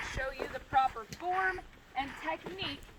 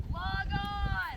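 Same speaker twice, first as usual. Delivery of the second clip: demo call; 5 semitones higher, 5 dB louder than usual. Shown as a demonstration. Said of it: "the donkey will say".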